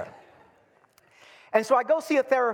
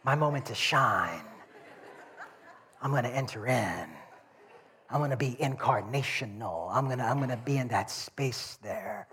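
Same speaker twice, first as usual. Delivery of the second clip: soft, low inner voice; private resolve; 5 semitones lower, 6 dB quieter than usual. Retold or imitated. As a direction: murmur it low, as if steeling yourself to step in. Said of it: "But I thought".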